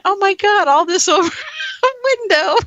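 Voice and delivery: high, laughing "oh my God" half in tears; laughing disbelief. Retold or imitated. Retold panic over broken window.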